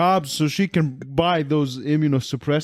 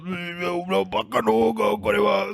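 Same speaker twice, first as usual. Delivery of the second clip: higher, hammed-up frantic babble; comic pitched-up yelling; mock anime panic; 4 semitones higher than usual. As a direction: imitate a panicking anime character. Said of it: "he goes".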